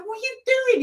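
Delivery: very high-pitched mock exclamation; incredulous agent. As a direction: squeal it in comic disbelief. Retold agent challenges Joey again.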